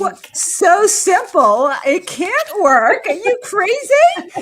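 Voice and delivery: high, loud incredulous laugh; mock disbelief. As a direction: act amazed it could work.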